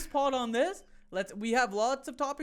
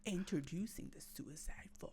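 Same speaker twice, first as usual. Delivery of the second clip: breathy whispered tagline; 5 semitones lower, 12 dB quieter than usual. Acted. Teasing his co-host, whispered tagline. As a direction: whisper it like an eerie secret.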